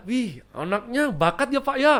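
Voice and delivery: high, admiring neighbour voice; impressed neighbours. Acted. Neighbours praise the singing child to Papa, admiring. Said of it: "Dan tetangga, tetangga, tetangga".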